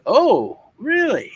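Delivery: high, rising mock disbelief; mock surprise. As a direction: play the cop's sarcastic surprise. Police react to stolen car report.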